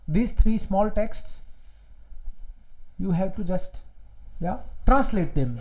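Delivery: very slow homework instruction. Shown as a demonstration. Teacher giving homework slowly to the class. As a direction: spell out the task slowly. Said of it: "as a homework".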